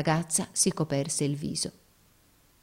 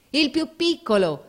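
high, impatient command; grido imperioso, impaziente; 10 semitones higher, 4 dB louder than usual. A narrator's reading. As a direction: demand it in a raised sailor's shout. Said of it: "gridarono in coro i marinai con imperiosa impazienza". (grido acuto con imperiosa impazienza)

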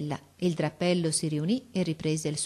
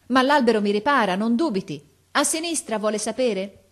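raised voice from above; calling down; 5 semitones higher, 5 dB louder than usual. A narrator's reading. Boy reassures officer below, calling. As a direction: call it down confidently.

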